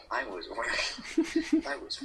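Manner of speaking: laughing through the words; can't stop laughing while reading. Real, not acted.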